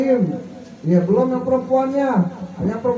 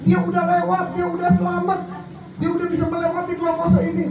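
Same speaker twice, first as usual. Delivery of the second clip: rising sermon voice; 8 semitones higher than usual. Acted. Preaching climax, raised pitch.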